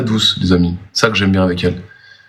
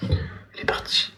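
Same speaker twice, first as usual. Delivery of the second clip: whispering; 12 dB quieter than usual. Real, not acted. Elle est partie, il chuchote.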